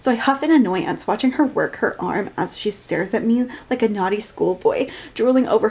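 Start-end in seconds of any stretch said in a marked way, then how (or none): none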